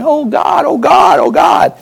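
high pleading cry, fading to a breath; imitating desperate prayer; mock crying out. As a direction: cry out to God, then trail off.

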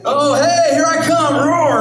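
a goofy lion giving itself away; silly lion. Pretend. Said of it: "he's not sitting there like".

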